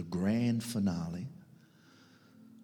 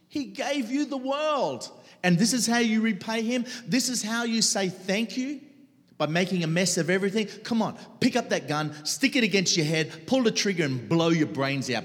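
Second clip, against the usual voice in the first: loud, higher, taunting yell; screaming inner voice; 12 semitones higher, 7 dB louder than usual. Retold or imitated. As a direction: hurl it like a cruel voice inside his head. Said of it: "this voice screaming in my head".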